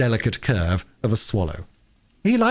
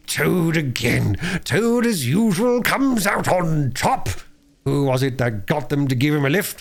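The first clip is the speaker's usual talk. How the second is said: gloating Toad; 9 semitones higher than usual. A narrator's reading. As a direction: revel in your own genius.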